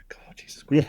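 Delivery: exasperated whisper. Real, not acted. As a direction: breathe it out in despair. Mock exasperation after bad attempt.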